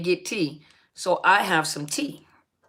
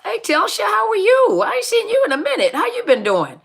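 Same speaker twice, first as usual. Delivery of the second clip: excited; 11 semitones higher, 4 dB louder than usual. Real, not acted. Excited greeting to returning viewer.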